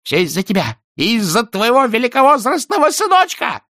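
high loud screaming accusation; enraged husband shrieking; furious. A narrator's reading. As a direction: hurl the blame at her.

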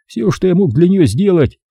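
plain reading voice

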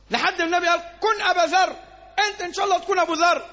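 impassioned preaching. Acted. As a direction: build the story to a passionate peak. Abu Dharr's walk, at full force. (الخطيب يروي قصة أبي طالب بحماس وصوت مرتفع)

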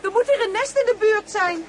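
high, loud, agitated realisation; alarmed at a nest nearby; anxious exclamation. Real, not acted. Wasps swarm around them.

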